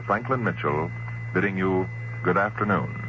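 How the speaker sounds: normal talking voice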